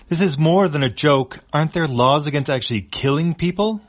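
high, loud outraged yell; yelling back. Acted. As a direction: shout it back in protest. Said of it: "Tesfaye yells back".

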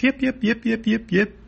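high-pitched rhythmic yips; cartoon animal yapping; yipping creature. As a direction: yelp like a little dog on the run. Ruddock goes yip while chased.

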